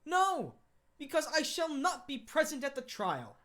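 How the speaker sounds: bold declaration. Acted. Lupin's bold declaration to judge.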